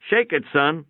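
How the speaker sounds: put-on a quick order tossed to the boy; calling out to the boy. A narrator's reading. Calling to boy holding light.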